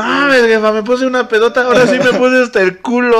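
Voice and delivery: high, loud tipsy confession; mock drunk kid. Teen telling his mom he got drunk, high giddy voice.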